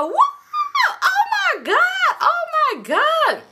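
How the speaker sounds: very high, drawn-out shriek; hammed-up stretched-out screaming; mock panicked scream. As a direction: imitate drunk onlookers screaming in alarm. Drunk panic at seeing gun.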